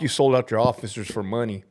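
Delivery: usual speaking voice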